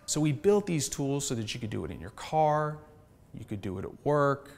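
soft, hushed guided-meditation delivery. Shown as a demonstration. Founder demoing a breathing tool to investors, meditation voice. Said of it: "put my meditation voice on".

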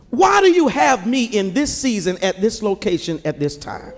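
ordinary talk, nothing marked

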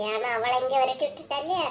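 normal talking voice

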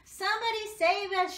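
high, loud panicked shriek; hammed-up terrified cry from the dogs; shrieking for help. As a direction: scream for rescue. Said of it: "shrieked the dogs".

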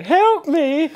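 high, loud shouted plea; shrill-pitched pretend distress call for recording; mock cry for help. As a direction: yell it out like a hammed-up victim. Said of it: "I'm just gonna go".

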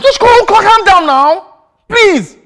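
very high, loud, pleading shout; frantic, high-pitched appeal; exasperated plea. Acted. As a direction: beg loudly for calm, stretching the words. Trying to calm an angry partner.

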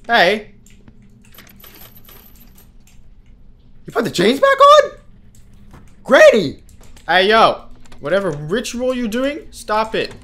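loud panicked shouting at the game villain; yelling at Granny. Real, not acted. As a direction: yell in panic as she appears.

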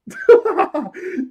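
high shrieking laugh; loud burst of laughter. Real, not acted. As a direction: explode into laughter. Laughs at wanted poster.

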